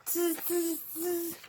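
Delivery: high-pitched hissy "tz" monkey sounds; monkey chatter. A narrator's reading. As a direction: mimic mocking monkeys, tz by tz. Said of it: "monkeys only stamped their feet back at him and said".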